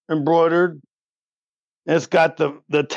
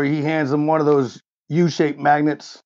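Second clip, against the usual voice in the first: ordinary talk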